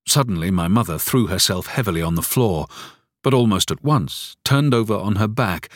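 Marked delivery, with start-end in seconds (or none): none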